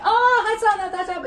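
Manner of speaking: high, loud greeting call; excited greeting. Retold or imitated. Koji greeting a friend on the street, high and loud. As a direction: Koji calls out a bright hello. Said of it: "Koji's like".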